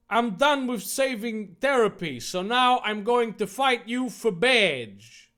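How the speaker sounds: high, loud battle declaration; loud challenge. Acted. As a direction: announce the fight like a cartoon hero. Dave challenges Ross in story.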